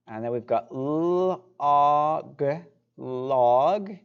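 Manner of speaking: slow, stretched "log"; drawn out vowels. Shown as a demonstration. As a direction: hold the vowel in "log" long. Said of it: "stretch out the vowels".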